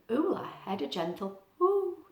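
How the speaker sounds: ordinary delivery, nothing marked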